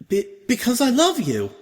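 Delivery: high, loud stammered confession; Octavia, blurting confession. Acted.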